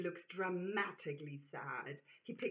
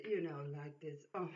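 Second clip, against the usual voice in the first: ordinary delivery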